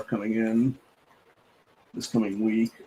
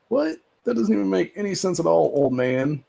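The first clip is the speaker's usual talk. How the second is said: mock disbelief, pitched way up and easing down; acted incredulity; 7 semitones higher than usual. Streamer answering the rental scammer's excuse, acted incredulity.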